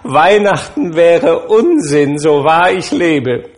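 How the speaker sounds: shouting in mock outrage. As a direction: ham up the grumpy uncle's outburst. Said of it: "schrie Scrooges Neffe".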